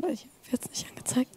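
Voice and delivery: fast unvoiced whisper to himself; whispered aside. Real, not acted. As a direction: mutter it under your breath, quickly. Muttering to himself during demo.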